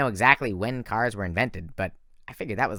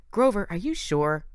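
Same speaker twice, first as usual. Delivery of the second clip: put-on higher, doubting girl's voice; voiced Thalia; 9 semitones higher than usual. A narrator's reading. Thalia questioning Grover about the spell, higher and doubtful. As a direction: sound unconvinced as the punk girl. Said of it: "Thalia was saying".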